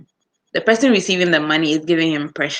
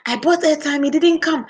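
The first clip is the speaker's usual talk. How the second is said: higher-pitched imitation of a shouting caller; mock angry customer; 9 semitones higher than usual. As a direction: play the irate customer, pitched up. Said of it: "the person is shouting".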